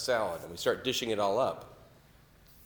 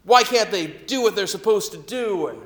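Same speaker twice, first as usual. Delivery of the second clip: furious yelling; 8 semitones higher, 6 dB louder than usual. Retold or imitated. Angry dad yelling at the waitress over tomatoes, furious shouting. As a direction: yell at the waitress like an enraged father. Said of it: "He starts yelling at the manager".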